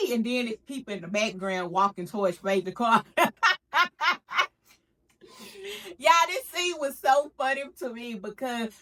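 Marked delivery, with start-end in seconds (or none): 3.1-4.5 high, loud burst of laughter. Real, not acted. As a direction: laugh hard. Laughs at the scene.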